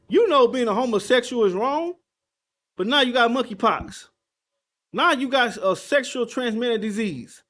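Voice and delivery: loud, emphatic street sermon; forceful preaching. Real, not acted. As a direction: drive each charge home loudly.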